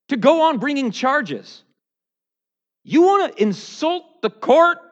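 loud, high-pitched scolding; indignant rebuke. Acted. Preacher defending his attorney against the accuser, indignant rebuke.

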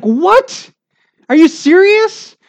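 a fan yelling at the referee; a shrill high "are you serious" at a bad call; indignant outburst. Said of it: "I'm upset".

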